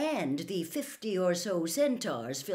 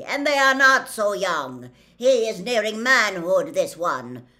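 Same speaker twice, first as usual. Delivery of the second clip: loud, forceful, higher-pitched argument; centaur; 3 semitones higher, 12 dB louder than usual. A narrator's reading. Centaur gripping Harry disputes his youth, loud and forceful. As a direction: project it like a stern centaur. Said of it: "replied the centaur".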